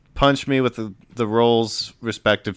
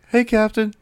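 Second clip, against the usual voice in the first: put-on much higher boyish voice; young boy; 11 semitones higher than usual. Young deckhand Enrique greets his captain, high boy's voice. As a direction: greet her as a young lad, pitched high. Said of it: "a young boy about your age".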